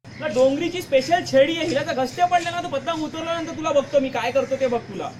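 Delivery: loud furious scolding; threatening elder brother, loud and heated; angry shouting. Acted. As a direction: yell at the careless kid. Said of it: "तू माझ्यावर ओरडू नको रे".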